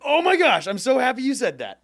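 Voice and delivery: light up at the question; real delight. Real, not acted. Guest to the host about his question, thrilled.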